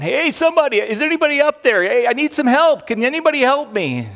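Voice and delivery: high, loud desperate calls; stranded hiker shouting upward; shouting for help. Retold or imitated. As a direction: yell up the cliff for help. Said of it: "he starts shouting".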